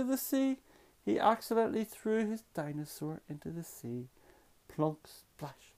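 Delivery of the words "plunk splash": usual speaking voice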